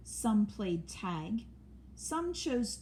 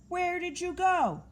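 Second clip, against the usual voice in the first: high, louder puzzled question; puzzled call; 10 semitones higher, 4 dB louder than usual. A narrator's reading. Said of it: "said Bear".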